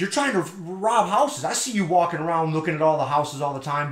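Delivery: worked-up mock accusation; retold accusation. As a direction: imitate an indignant accuser laying out the charge. Neighbor's accusation acted in retold quarrel.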